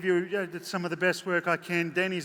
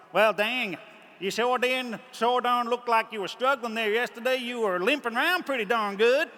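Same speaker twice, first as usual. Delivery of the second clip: high, loud American Southern twang; imitated Southern drawl; 6 semitones higher, 3 dB louder than usual. Imitating the American man who teases him at the hotel desk. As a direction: play the nosy American gentleman, loud and high. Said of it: "I won't do his accent justice".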